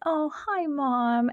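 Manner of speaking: melting little greeting; feeling loved. Retold or imitated. Child answering mom's morning welcome, feeling loved. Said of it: "you just felt so loved".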